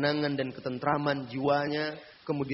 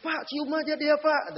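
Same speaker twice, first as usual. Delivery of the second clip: student's yell; 14 semitones higher, 4 dB louder than usual. Retold or imitated. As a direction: shout the dare across the class. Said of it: "langsung dia teriak".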